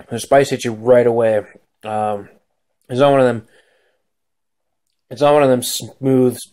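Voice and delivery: talking with mouth full; words between bites of food; chewing while talking. Real, not acted. Seen 1: bites burger mid-phrase, mouth working; burger at lips throughout.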